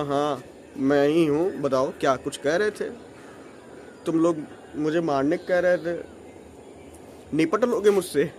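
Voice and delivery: mild tough-guy confrontation; low, cool challenge. Retold or imitated. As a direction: square up to them calmly. Confronting the relatives.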